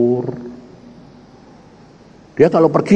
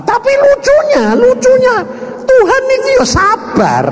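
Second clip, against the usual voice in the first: high, louder gleeful exclamation; delighted; 4 dB louder than usual. Real, not acted. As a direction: relish the funny twist. Preacher's delight at the punchline. (seruan gembira bernada tinggi)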